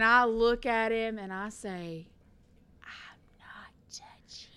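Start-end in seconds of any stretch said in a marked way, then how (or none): 2.8-4.5 slow, breathy mouthed taunt; whispered taunt. Retold or imitated. Taunting her brother in the back seat, whisper. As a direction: tease him without a sound.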